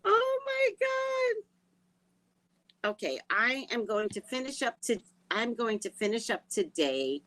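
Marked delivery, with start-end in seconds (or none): 0.0-1.5 much higher, drawn-out squeal; excited shriek. Real, not acted. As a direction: stretch it into a high squeal. Loud squeal.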